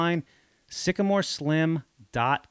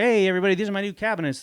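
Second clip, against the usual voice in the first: higher, bright show-off announcement; mock proud host; 8 semitones higher, much faster than usual. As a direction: present the cabinets like a proud homeowner. Imagined show-off announcing cabinets.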